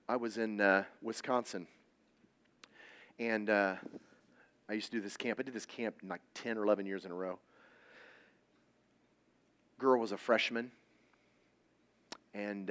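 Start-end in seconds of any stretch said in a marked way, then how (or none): none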